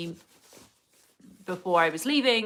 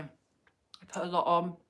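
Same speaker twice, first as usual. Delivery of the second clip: ordinary talk, nothing marked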